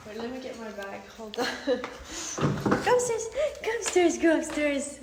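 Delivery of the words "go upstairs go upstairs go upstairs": high, loud laughing call to go upstairs; playful calling out. Real, not acted. Mom playfully calling her toddler up the stairs, high and loud.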